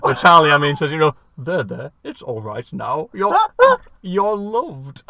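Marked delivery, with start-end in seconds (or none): 1.4-5.0 softer, slow, tender reassurance; comforting. Retold or imitated. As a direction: soothe him like a crying child. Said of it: "Patrick goes".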